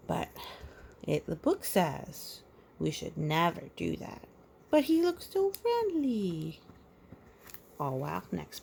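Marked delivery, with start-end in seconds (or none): 4.7-6.6 high, bright pleading; mock plea. Real, not acted.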